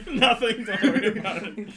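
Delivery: laughing. Real, not acted. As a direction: giggle the words out. Still laughing after joke.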